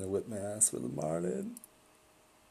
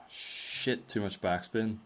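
usual speaking voice

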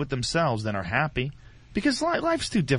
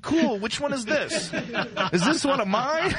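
casual delight; 11 semitones higher than usual. Retold or imitated. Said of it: "I'd be like".

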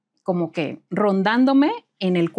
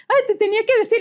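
sheepish reply; 13 semitones higher, faster than usual. Retold or imitated. Lupita's sheepish high reply.

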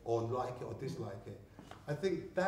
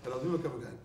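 normal talking voice

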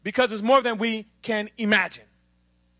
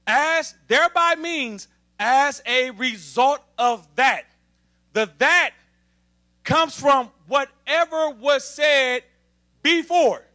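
slow, deliberate explaining, "thereby" stressed; slower than usual. Shown as a demonstration. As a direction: spell it out slowly for the church. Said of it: "let me slow it down".

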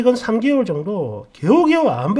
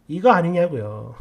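ordinary talk, nothing marked

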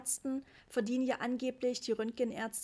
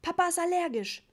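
loud, high-pitched scolding; mother shouting; 8 semitones higher, 7 dB louder than usual. A narrator's reading. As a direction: yell the warning at your child. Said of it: "schrie Mama". (laut schreiende Mutter)

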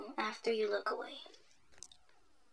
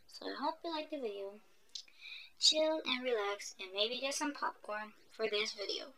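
ordinary delivery

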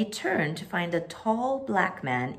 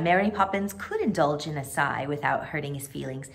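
plain reading voice

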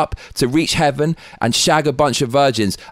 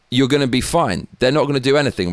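ordinary talk

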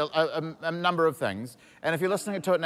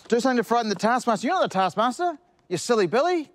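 exasperated telling off; 10 semitones higher, 4 dB louder than usual. Real, not acted.

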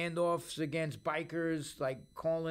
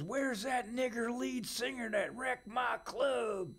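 mock angry owner; 6 semitones higher than usual. Angry club owner with bats.

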